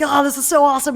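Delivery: gush, riding the high; reenacted elation. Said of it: "I was, like, on a high".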